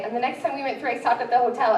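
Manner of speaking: ordinary talk, nothing marked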